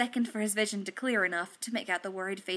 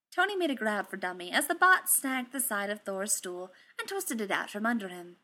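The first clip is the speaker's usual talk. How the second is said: ordinary delivery, nothing marked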